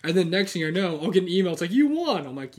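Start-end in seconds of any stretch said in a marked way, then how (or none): 1.7-2.3 cheery announcement voice. Retold or imitated. Quoting eBay email.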